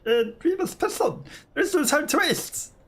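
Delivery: very high, formal character voice; eager courteous character. Acted. Character urges the party to press on, high courteous role voice. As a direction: play a high-voiced companion, keen to press on.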